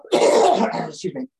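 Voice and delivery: hoarse, scratchy voice from a cough; talking through a sore throat; croaky voice. Real, not acted. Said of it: "It's just the old-fashioned, um, croaky voice".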